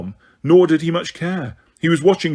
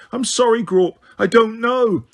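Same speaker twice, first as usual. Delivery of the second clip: frightened; 8 semitones higher than usual. A narrator's reading. Hermione terrified of giant.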